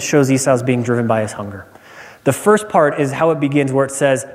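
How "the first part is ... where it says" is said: normal talking voice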